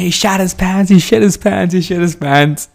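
high mocking chant; sing-song schoolyard taunt; kids taunting. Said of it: "everybody's screaming".

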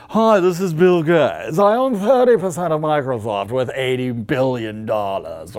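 slow, affected rich-man voice; Bill Gates. Acted. Rich Bill Gates calling Microsoft, affected and slow. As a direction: put on a drawling mogul's phone manner. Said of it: "you talk like that".

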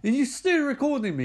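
high-pitched mock-furious scolding. Girlfriend scolds boyfriend for still filming, mock furious. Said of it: "she's furious".

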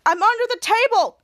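high, loud whiny call. Acted. Piper answering from under the table, calling out. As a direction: call from your hiding place. Girl calls out from hiding.

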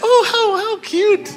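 very high, gushing falsetto; mock cooing. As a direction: coo as if over something adorable. Mocking a cooing reaction to mosquito.

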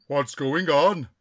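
slow, loud spirit-guide voice; caricatured spirit chief. Acted. Spirit chief puzzled in the séance, slow and loud. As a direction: boom it out slowly as a chief.

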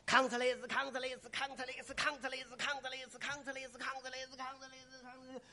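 soft, even gong pattern by mouth; imitated percussion. Shown as a demonstration. Drummer playing Cao Cao off, soft mouthed percussion. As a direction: chant the drum pattern, fading.

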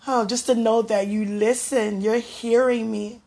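normal talking voice

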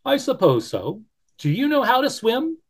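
the Beaver weighing it up aloud; Beaver's voice. Acted.

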